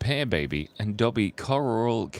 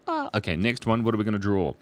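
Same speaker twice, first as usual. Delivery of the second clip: ordinary delivery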